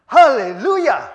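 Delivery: loud, soaring hallelujah; joyful shout. Real, not acted. Preacher crowning the story for the congregation, a loud joyful shout. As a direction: shout it out in triumph.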